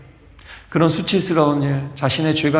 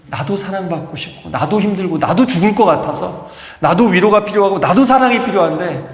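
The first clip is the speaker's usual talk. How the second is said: neglected son's plea, building louder and higher; pleading protest; 4 semitones higher, 3 dB louder than usual. Acted. Surviving son pleading with his mother for love, impassioned protest. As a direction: press each plea harder, voice rising.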